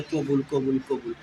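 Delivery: barely voiced "কবুল, কবুল"; mumbled quietly. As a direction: mumble "কবুল" shyly under the breath. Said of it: "আস্তে আস্তে বললে দেয়".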